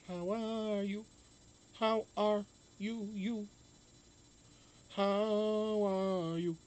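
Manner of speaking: slow, stretched how are you; drawn-out greeting. Shown as a demonstration. Greeting the chat with a slow, stretched how are you. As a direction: savour each word of the greeting. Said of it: "why not make a nice version of it".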